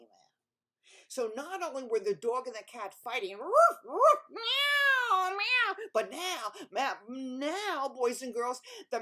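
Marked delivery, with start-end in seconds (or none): 3.4-5.7 loud, high barking and meowing; noisy animal scrap; cat and dog fight. Acted.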